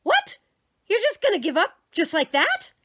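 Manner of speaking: very high loud disbelief; shocked mother. Acted. Mother stunned her son gives up a toy, high loud shock. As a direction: squeal in disbelief.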